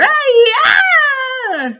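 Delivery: long, high wailing roar. A narrator's reading. Giant roars in his nightmare, high howl. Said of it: "roared the Flesh Lump Eater".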